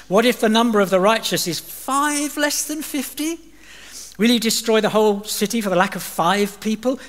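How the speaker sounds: lightly voiced haggling Abraham; bargaining Abraham. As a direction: push the number down gently.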